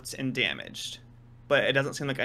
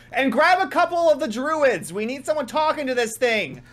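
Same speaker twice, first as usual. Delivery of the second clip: high, loud shout to the guards; yelling order; 10 semitones higher, 6 dB louder than usual. Acted. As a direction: call across the camp to fetch druids. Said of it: "she yells".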